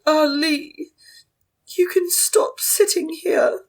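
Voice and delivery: high, refined lady voice; posh voice. Acted. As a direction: play a posh elegant matron. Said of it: "could you do your posh voice for Madame Jean".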